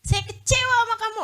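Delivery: hammed-up disappointed husband; husband scolding his wife, imitated; mock angry husband. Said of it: "Gitu kan".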